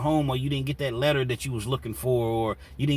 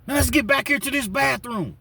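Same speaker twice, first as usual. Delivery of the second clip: high, louder angry challenge; heated challenge; 14 semitones higher, 6 dB louder than usual. Retold or imitated. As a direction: call him out to fight. Sean challenging to fight.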